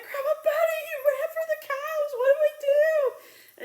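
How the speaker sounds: high, loud, frantic cry for help; high panicked pleading; panicked shout. Retold or imitated. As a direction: shriek it like she's freaking out. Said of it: "I'm like".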